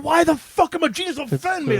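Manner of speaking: confused squeaky self; high puzzled drunk voice; bewildered, high-pitched; baffled high voice. Retold or imitated.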